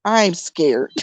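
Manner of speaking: low-pitched mock-scared sister, breaking into laughter; imitating scared sister. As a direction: play the reluctant sister, dropping low. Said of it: "She said".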